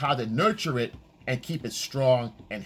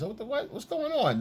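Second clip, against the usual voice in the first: pitched-up confused surprise; mock surprise; 10 semitones higher than usual. Boyfriend noticing she's gone distant, in mock surprise. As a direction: sound caught off guard, lightly. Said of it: "And you realize".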